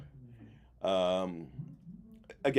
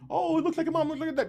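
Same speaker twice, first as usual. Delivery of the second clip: high cooing relative voice; gushing relative; 5 dB quieter than usual. Retold or imitated. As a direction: coo it like a doting aunt.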